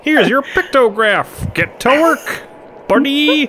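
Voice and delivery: very high, slow villain taunt; Joker. Acted. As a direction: taunt the hero in a squeaky comic-villain voice. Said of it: "That's my Joker voice".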